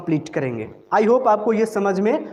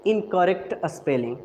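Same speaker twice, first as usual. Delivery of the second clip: normal talking voice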